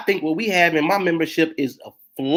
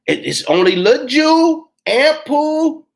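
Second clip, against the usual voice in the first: ordinary talk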